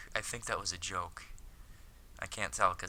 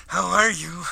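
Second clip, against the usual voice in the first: higher, louder, eager greeting; eager schoolboy; 11 semitones higher, 15 dB louder than usual. Acted. Hikaru asks his idol Akiko, eager and high. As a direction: pitch it up as a keen schoolboy.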